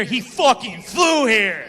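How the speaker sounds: loud, slow, emphatic, laughing; excited shout. Real, not acted. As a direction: hype up the crowd member. Excited crowd work.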